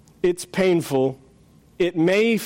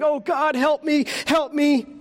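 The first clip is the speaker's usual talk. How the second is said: whimpering; 8 semitones higher than usual. Acted. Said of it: "ended up whimpering".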